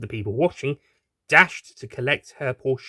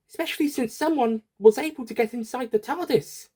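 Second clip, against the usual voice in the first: put-on high, anxious young woman's voice; a fearful realization in a lifted voice; Doctor's fretful thought; 12 semitones higher than usual. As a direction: worry aloud in the Doctor's high voice.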